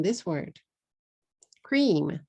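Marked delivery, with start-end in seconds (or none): 1.6-2.2 "Cream" in one long falling beat; one-syllable English. Shown as a demonstration. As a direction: say "Cream" with the cr joined and a closed m. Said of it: "One syllable".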